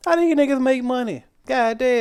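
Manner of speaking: high, loud disbelieving outburst; high-pitched what-the-hell rant; incredulous. Real, not acted. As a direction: squeal it in disbelief. Outraged disbelief at restaurants.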